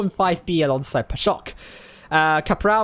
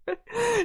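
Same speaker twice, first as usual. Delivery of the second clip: short, soft, high giggle; a sheepish little laugh; quiet giggle; 5 dB quieter than usual. Real, not acted. Caster laughs at lost voice.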